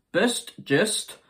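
Boost Just said high and loud, Boost punched; emphatic product name. Shown as a demonstration. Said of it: "determined to not do it this time".

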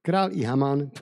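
steady storyteller's reading voice; measured Bible story narration. Acted. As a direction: read the tale aloud to listeners. Reads Esther story aloud.

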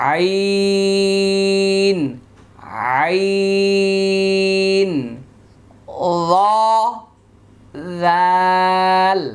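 "আইন" drawn out slowly, high and loud, then "জাল" stretched in the same way; Arabic letter sounds. Shown as a demonstration. Teacher showing students the ع sound, drawn out high and long. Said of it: "এটা মোটা, এটা পাতলা".

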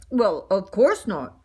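low, awkward defensive voice; man's sheepish reply. Acted. Mister Bing defends himself to the donut, low and awkward. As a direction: answer low, on the back foot.

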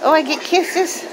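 high, gushing voice for a dog; delighted pet talk. Real, not acted. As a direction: squeal it at the licking puppy. Dog licking her face.

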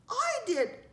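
high, loud declaration; bold ladybug. A narrator's reading. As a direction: announce yourself bravely. Brave ladybug answers the child.

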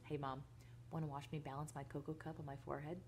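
hushed, low conspiratorial offer; whispered. A narrator's reading. Boy secretly offers mother a stunt, whispered. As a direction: keep it soft and low, under the breath. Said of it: "grinned broadly and whispered".

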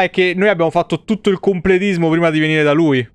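normal talking voice

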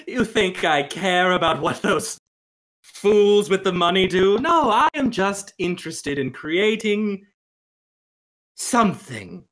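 high, loud scornful artist; scoffing. Acted. Game master voices Angelo dismissing his moneyed patrons, scoffing. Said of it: "Angelo scoffs".

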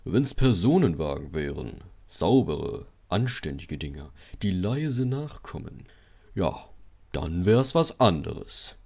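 low, slow, snobbish grumble; self-pitying big-engine voice; sulky engine. Acted. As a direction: complain haughtily, drawing out the words. Gordon sulking, snobbish.

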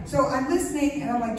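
ordinary delivery

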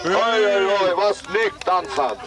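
rising excitement, high and eager as the goal comes; live play-by-play building to a goal. Acted. Commentator calling the first goal in the park, building excitement. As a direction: ride the attack up to the goal.

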